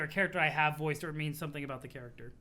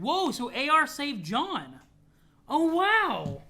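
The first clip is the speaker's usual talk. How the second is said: loud, high, drawn-out wow; amazed; 9 semitones higher, 9 dB louder, much slower than usual. Real, not acted. Reader learning who saved John, astonished. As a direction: react big to the reveal, stretching it out.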